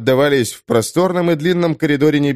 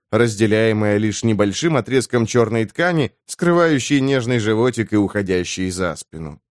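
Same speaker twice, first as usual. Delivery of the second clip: plain reading voice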